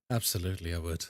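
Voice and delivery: low, softened replay of the reply; mock-suave acceptance. Streamer repeating the acceptance after laughing, mock-suave. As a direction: sink deep and quiet for a smooth yes. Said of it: "And I was like".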